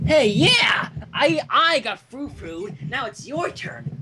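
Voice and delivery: teasing glee. Pretend. Player teasing Rarity's player.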